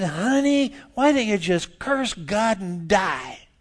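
drawn-out sugary wife talking to Job; wife's sweet voice. Retold or imitated.

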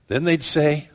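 ordinary talk

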